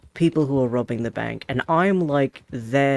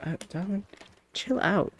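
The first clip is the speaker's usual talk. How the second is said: higher, much softer coaxing; calm a frightened animal gently; soothing; 6 semitones higher, 5 dB quieter than usual. Real, not acted. Soothing something upset.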